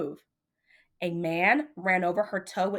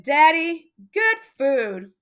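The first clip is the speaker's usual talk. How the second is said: high, louder sing-song declaration; proud kid; 6 semitones higher, 7 dB louder, slower than usual. Acted. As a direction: insist it is good food. Child presenting the treats.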